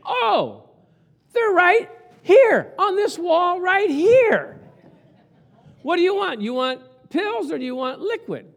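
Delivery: high, loud, emphatic saleslady voice; loud store clerk. Retold or imitated. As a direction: ham up a loud chatty saleslady. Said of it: "you're talking fast and you're talking loud".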